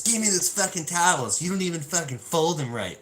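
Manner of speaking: snatch the towels away, furious; wife snapping. Retold or imitated.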